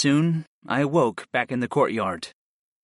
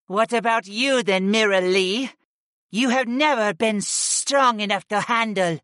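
high, sneering goddess; mock her rival from above; taunting goddess voice; 6 semitones higher than usual. A narrator's reading. Goddess Utuni taunts Mira Lee.

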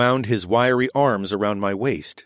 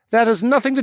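put-on a lightly raised gentle older man's voice; a hint of a kindly, unbothered friend; the Falcon Man, mildly voiced and matter-of-fact; lightly voiced Horace; 14 semitones higher, 4 dB louder, faster than usual. A narrator's reading.

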